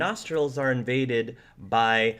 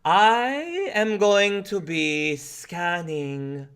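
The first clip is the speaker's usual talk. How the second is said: slow, higher, drawn-out seer voice; mystic fortune teller; 6 semitones higher, 3 dB louder, much slower than usual. Acted. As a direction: intone it like a theatrical psychic.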